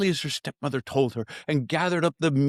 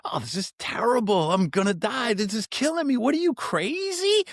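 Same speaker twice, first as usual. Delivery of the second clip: mock panic; 9 semitones higher than usual. Bather talking himself into panic in the ice, rising mock whine. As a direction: pitch climbing much higher with each line.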